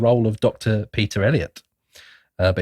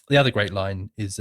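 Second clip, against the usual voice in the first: normal talking voice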